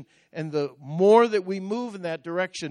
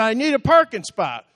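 loud, high-pitched driver's demand; retold shouted demand; 7 semitones higher, 8 dB louder than usual. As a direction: act out an insistent driver calling out. Driver shouting back in retold quarrel.

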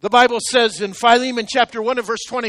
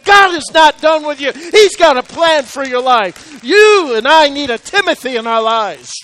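raised, loud, building exhortation; impassioned preaching; 4 semitones higher, 7 dB louder than usual. Real, not acted. Pastor urging the congregation to get back up, full-force preaching.

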